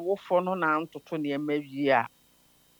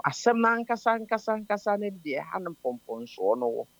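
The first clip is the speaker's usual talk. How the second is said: normal talking voice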